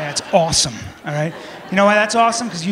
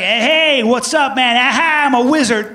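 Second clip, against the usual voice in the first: higher, louder excited hey; higher, louder mock party greeting; mock hyped greeting; 4 semitones higher, 4 dB louder than usual. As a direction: overeager guy calling out. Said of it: "You're like".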